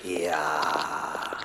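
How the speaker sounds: low, dark whispered yes; menacing whisper. Acted. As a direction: hiss the answer with menace. Boss confirms revenge plan.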